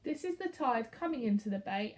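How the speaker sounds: ordinary delivery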